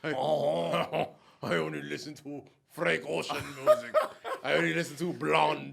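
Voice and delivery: playful, put-on Count Dracula accent; mock Count Dracula accent. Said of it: "what is that accent you're doing right now".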